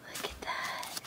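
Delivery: soft whisper of wonder; hushed whisper. Real, not acted. Peeking at the doll for viewers, hushed whisper. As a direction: whisper it close.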